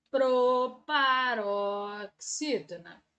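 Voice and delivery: very slow, low "proparoxítona"; drawn-out term. Shown as a demonstration. Teacher introducing a new term, drawn-out delivery. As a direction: stretch "proparoxítona" out syllable by syllable.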